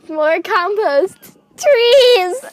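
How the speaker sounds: slow, building into a loud shout. Acted. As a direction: yell the cause, rising to a scream. Sister shouting her riot cause.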